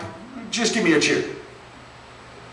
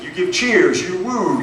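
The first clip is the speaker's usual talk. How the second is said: normal talking voice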